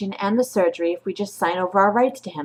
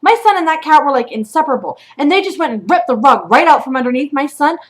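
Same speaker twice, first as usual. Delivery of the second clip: higher and louder, heated and indignant; angry rant; 6 semitones higher, 5 dB louder than usual. Real, not acted. Mother rants at the shelter over her son's cat, angry and loud. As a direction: rage on your son's behalf.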